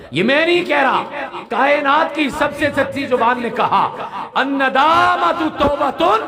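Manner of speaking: high loud preaching with a laugh; fervent quote. Real, not acted. Preacher quoting the Prophet to the crowd, high fervent cry.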